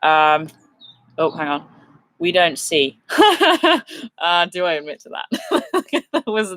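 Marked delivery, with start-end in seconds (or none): 3.0-4.1 high awkward giggle; nervous laugh. Real, not acted. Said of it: "that was a nervous laugh". 6.1-6.6 nervous laugh. Real, not acted. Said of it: "that was a nervous laugh".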